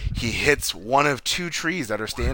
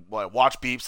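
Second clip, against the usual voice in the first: ordinary talk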